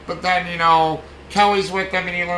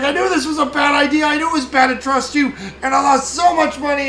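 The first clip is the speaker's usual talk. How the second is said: high, panicked ranting; freaking out; 8 semitones higher, 3 dB louder than usual. Retold or imitated. Pritchett panicking as helicopters arrive, high frantic rant. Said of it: "like, freaking out".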